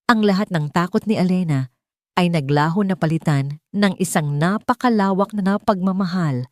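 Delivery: ordinary delivery, nothing marked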